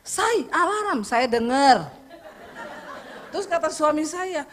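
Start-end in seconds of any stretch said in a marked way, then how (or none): 0.1-1.0 husband calling out. Retold or imitated. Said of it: "dia teriak".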